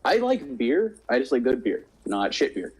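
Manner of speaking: normal talking voice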